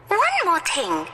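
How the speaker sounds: put-on high-pitched excited girl; high girlish storybook voice; little girl character. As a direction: show off a find in a high child's voice.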